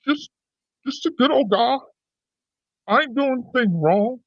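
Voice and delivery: a hint of a good-old-boy Texas accent. Host tries a Texas accent on the viewers. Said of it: "when I pull the Texas accent on it".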